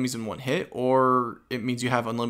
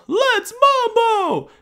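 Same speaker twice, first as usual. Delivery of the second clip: much higher, louder and slow, hammed up; silly high voice; 8 dB louder, much slower than usual. Gamer belts the Latin slogan in a squeaky slow voice. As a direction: stretch it out in a squeaky party voice. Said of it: "uh, let's mambo".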